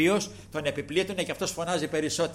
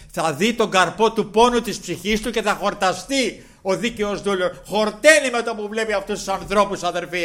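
loud, high impassioned sermon voice; fiery preaching; 6 semitones higher, 9 dB louder than usual. Acted. Preacher to congregation about Mary Magdalene, fiery full-force preaching. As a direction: proclaim it to the whole church.

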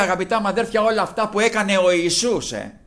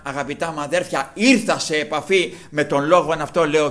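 usual speaking voice

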